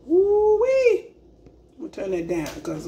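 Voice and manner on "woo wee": excited whoop. Real, not acted. Tasting spicy food, exclaims.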